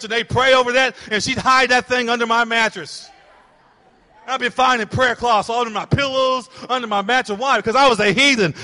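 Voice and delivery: loud, driving preacher's cadence; full-force preaching. Real, not acted. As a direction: preach it out, pressing hard on the church.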